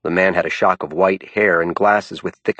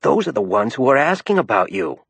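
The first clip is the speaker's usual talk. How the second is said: Ruth whispering; 6 semitones higher than usual. A narrator's reading. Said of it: "whispered Ruth".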